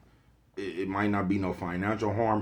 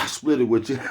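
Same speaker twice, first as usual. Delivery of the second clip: laughing; 6 semitones higher, 11 dB louder than usual. Real, not acted. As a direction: talk breaking into a big laugh. Joke about splitting dope.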